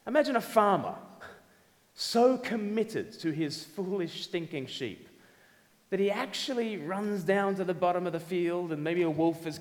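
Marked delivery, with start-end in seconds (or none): none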